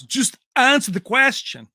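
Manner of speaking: Van Damme impression. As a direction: snap at the reporter like Van Damme. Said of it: "he goes".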